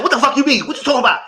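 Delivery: loud, high, indignant outburst; retold hissy fit. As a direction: mimic him blowing up in a hissy fit. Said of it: "he had a whole hissy fit".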